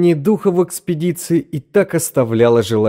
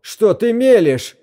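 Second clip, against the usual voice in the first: high, loud slow shout; 11 semitones higher, 3 dB louder than usual. A narrator's reading. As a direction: yell it in disbelief. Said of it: "закричал Кейбл".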